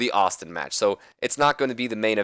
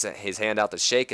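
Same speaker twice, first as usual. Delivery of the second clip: ordinary delivery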